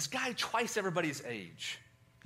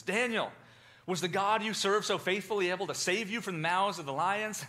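louder, higher anxious call into the den; the king calling into the lions' den, louder and anxious; 5 semitones higher, 5 dB louder than usual. Retold or imitated. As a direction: call down hoping against hope.

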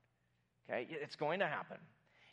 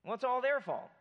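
defensive; 6 semitones higher, 5 dB louder than usual. Retold or imitated.

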